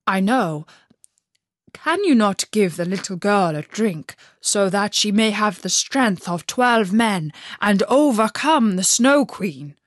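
a reindeer earnestly begging for help. Acted. Reindeer pleads for Gerda.

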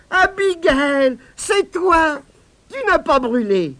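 high, loud, slow joyful relief; overjoyed. A narrator's reading. Boy greeting the spider he thought burned, overjoyed. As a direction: savour the happy surprise.

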